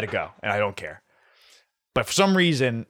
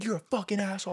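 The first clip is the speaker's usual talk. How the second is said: mock angry yell; 7 semitones higher, 6 dB quieter, faster than usual. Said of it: "it was a fucking screaming match".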